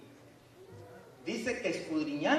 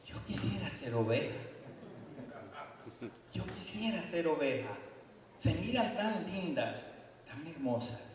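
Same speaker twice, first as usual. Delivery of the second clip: wolf's longing; 3 semitones lower, 7 dB quieter than usual. Acted. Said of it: "y dice".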